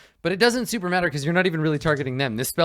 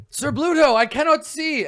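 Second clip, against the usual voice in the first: high-pitched, raised call; knight's shout; 12 semitones higher, 4 dB louder than usual. Acted. Knight to his leader in pitch darkness, shouting. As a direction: cry out as a helpless knight. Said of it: "one of the knights from the corner shouts".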